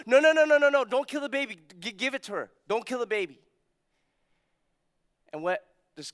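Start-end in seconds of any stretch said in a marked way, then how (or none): 0.1-3.4 high, loud, frantic cry of no; a mother pleading in panic for her child; desperate mother's plea. Retold or imitated. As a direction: beg for the baby's life, much higher and louder. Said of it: "one of the mom goes".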